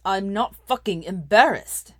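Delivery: loud, slow angry denial; spat out. A narrator's reading. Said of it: "he spat".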